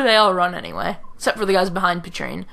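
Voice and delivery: usual speaking voice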